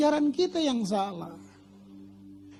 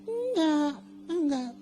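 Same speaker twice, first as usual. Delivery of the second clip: tearful; 3 semitones higher, 4 dB quieter, slower than usual. Retold or imitated. Preacher reliving his temptation.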